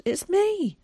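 put-on high-pitched boy's voice; insistent plea. Boy pleading to be recognised.

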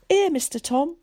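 lightly voiced boy; small boy. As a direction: answer up in a thin child's voice. Child answers from beside him.

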